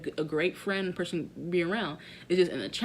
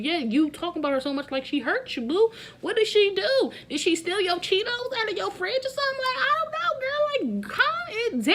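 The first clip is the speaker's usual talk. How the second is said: higher, sassy mock talk-down; 13 semitones higher than usual. Vlogger sassing a cast member, high and teasing. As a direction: play it high and teasing, brushing her off.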